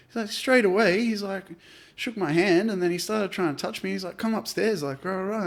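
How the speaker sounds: put-on upset girl. Girl retelling harassment.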